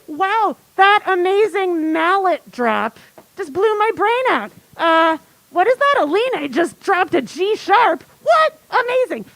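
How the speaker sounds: hammed-up amazement, high and loud; mock astonishment, pitch leaping up; amazed fan reaction. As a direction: gush like a concertgoer whose mind is blown. Acting out an amazed chat reaction.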